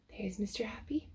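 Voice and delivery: hushed aside pointing him out; whisper, as if not to disturb him. Real, not acted. Pointing out Mister Happy to the child, whispering.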